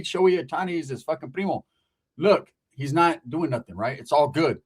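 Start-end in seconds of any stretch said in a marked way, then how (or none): none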